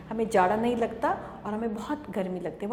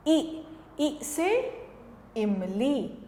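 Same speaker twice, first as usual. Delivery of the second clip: normal talking voice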